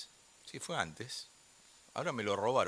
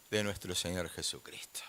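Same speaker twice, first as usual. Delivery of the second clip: low, soft, whispery answer; reverent hush; 7 semitones lower, 6 dB quieter than usual. Real, not acted. Preacher answers his question to the church, hushed.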